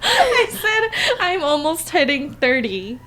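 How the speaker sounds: high, slow, through helpless laughter; laughing protest. Real, not acted. Teased sister corrects her slip, laughing hard. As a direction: barely get it out for laughing.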